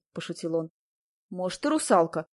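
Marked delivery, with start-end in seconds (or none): none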